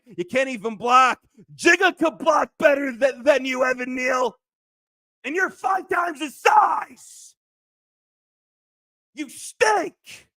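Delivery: real fan fury aimed at Evan Neal; screaming. Real, not acted. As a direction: scream like he's watching. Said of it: "I'm screaming like Evan Neal's freaking watching this".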